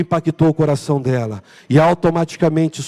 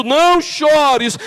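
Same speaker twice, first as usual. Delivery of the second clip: slow tender voice; 7 semitones higher, much slower than usual. Acted. Preacher voices God's gentle words.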